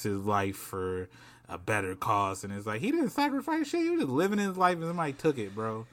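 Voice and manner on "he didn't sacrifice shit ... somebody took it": high-pitched, scoffing disbelief; incredulous objection. Real, not acted. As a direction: shoot up high in protest, then ease down. Scoffs at Pelosi's sacrifice remark.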